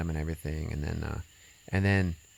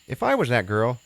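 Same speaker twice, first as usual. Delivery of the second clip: a hammed-up threat in a higher voice; mock vengeful girl; 7 semitones higher, 5 dB louder than usual. Imagining the girl's revenge, higher and louder. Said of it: "you know, you're like".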